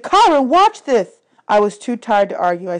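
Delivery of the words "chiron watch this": high, loud, slow call for attention; eager girl. A narrator's reading. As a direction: make them all look. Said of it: "she said".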